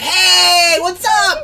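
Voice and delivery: high, loud cheery squirrel voice; chirpy excited squirrel greeting. Acted. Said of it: "he's like".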